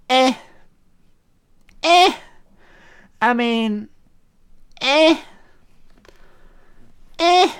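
high, scoffing "eh"; mocking eh. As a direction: scoff it off, a dismissive eh.

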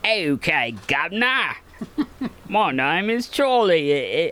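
put-on a faint, failed Cockney accent; bad Cockney accent. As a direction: try Cockney and barely get there. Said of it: "terrible Cockney accent".

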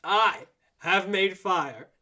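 loud, slow, drawn-out declaration; triumphant proclamation. Acted. Host quoting a film line for listeners, triumphant proclamation. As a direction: proclaim it with big pauses between words. Performing a movie line for guessing.

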